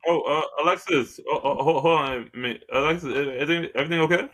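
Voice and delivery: normal talking voice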